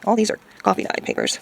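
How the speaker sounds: ordinary talk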